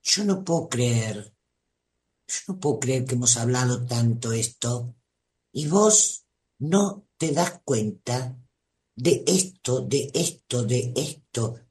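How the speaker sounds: guilt-trip them quietly, shaking your head; manipulative reproach. Acted. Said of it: "te lo voy a actuar, que para eso soy actriz".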